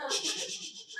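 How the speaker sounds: repeated unvoiced shushes; urgent shushing. Fan hushing the man in the Mickey suit, urgent shushing. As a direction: hush them with a string of quick shh sounds.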